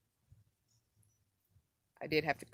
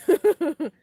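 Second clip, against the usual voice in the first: laugh; 12 semitones higher, 15 dB louder than usual. Real, not acted. Laughs about the fourth.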